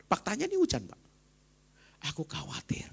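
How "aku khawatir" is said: whispered Aku khawatir; a quiet admission of worry, almost whispered; hushed confession. Real, not acted. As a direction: breathe it out as a whisper. Admitting worry.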